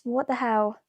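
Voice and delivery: normal talking voice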